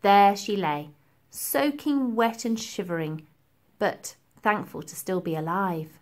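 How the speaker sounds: usual speaking voice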